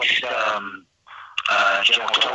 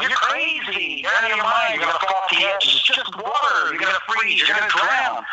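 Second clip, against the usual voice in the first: raised, scoffing voice of the doubters; mock the people who thought he was crazy; 7 semitones higher than usual. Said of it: "And people going".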